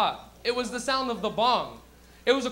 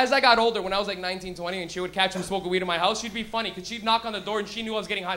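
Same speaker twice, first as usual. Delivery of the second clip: ordinary talk, nothing marked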